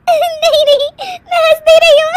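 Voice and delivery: high, loud denial through giggles; laughing denial. Acted. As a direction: insist while helplessly laughing. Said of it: "हंस क्यों रही हो".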